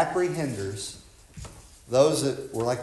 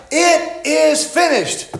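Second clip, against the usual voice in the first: high, slow proclamation of "It is finished"; cried aloud; 10 dB louder, slower than usual. Acted. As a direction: let "It is finished" ring out high and drawn out. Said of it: "Cries aloud from Calgary".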